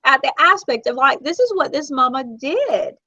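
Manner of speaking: high, emotional, crying voice; tearful. Real, not acted. Telling of a mother giving up her child, tearful. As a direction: keep talking through rising tears. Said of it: "Look, I'm fixing to cry now".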